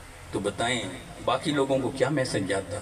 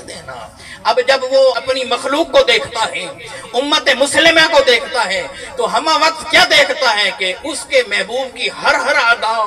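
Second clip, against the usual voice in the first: much higher and louder impassioned preaching with laughter; fervent preaching; 10 semitones higher, 11 dB louder than usual. Acted. Preacher to congregation, soaring fervent preaching. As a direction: lift the hall with joyful fervour.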